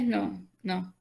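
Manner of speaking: normal talking voice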